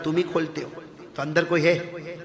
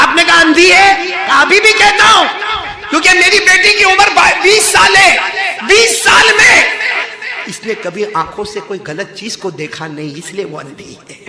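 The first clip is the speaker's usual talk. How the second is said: full-force preaching, higher and louder; 14 semitones higher, 16 dB louder than usual. Acted. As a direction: drive the command home to the crowd. (پورے زور سے وعظ)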